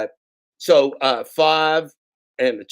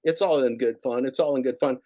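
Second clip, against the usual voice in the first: normal talking voice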